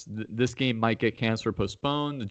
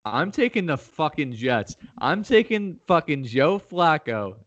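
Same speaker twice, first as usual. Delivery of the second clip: higher, emphatic declaration of the pick; defiant hype; 5 semitones higher than usual. Real, not acted. Host defends his Jets pick to a doubting co-host, defiant hype. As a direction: double down with rising excitement.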